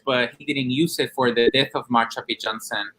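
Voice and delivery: usual speaking voice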